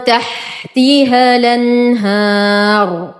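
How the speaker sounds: slow تحتها الأنهار with the hamza carried over; tajweed recitation. Shown as a demonstration. Teacher models Warsh naql for students. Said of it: "همزة الوصل تسقط".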